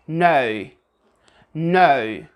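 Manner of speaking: no with a pure, complete British O. Shown as a demonstration. Teacher models British no with a complete O. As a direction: say no with a full British O. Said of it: "For example, they say".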